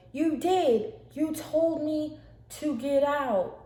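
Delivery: loud, high, slow and drawn out; emphatic accusation. Retold or imitated. Rebecca throws the order back at her sister, emphatic. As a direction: hammer each word back at her. Acted quarrel, insisting on accusation.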